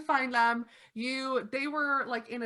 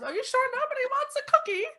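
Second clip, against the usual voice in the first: put-on doting old lady; faster than usual. Old lady fusses over the vampire who broke her glass. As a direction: coo over the guest.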